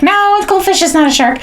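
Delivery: put-on high-pitched child's voice, far above your own; kid protesting. Child answering parent's silly goldfish question, high kid voice. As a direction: squeal it like a kid correcting a grown-up. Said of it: "the kid's like".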